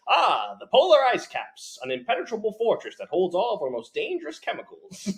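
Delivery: put-on high, grand old-timey announcer; 1950s infomercial voice. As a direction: sell it like a fifties ad. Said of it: "nineteen fifties, like, infomercial".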